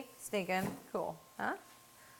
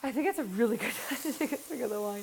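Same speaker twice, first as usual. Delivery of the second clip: laughing agreement; 3 semitones higher than usual. Real, not acted. Cook agreeing to a swig of wine, laughing. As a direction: talk through a laugh.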